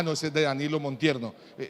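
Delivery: ordinary talk, nothing marked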